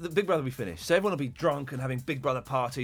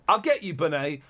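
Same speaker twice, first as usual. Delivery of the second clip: ordinary talk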